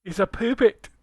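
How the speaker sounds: mock Scottish accent on puppet. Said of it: "say puppet with a Scottish accent".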